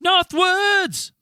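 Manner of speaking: big dramatic announcement, high and loud; announcer shout. Acted. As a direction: boom it out like a show host. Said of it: "You say it so friendly".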